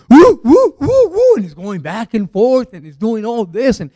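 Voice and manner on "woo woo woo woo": loud, very high-pitched gorilla whooping; high mock ape hoots. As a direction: whoop like someone imitating a gorilla. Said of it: "he starts acting like a gorilla".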